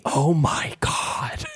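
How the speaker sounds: voiceless stretched-out "mouthed" whisper. Retold or imitated. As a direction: mime it in an exaggerated, drawn-out whisper. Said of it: "He mouths to me".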